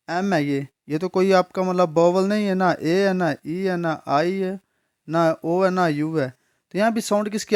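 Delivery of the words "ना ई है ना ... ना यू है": normal talking voice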